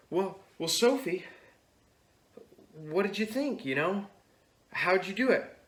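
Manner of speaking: high-pitched, careful questions; acted uneasy cheerfulness, high; tentative brother. Brother nervously asks cross sister.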